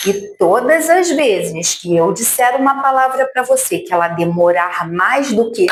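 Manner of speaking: usual speaking voice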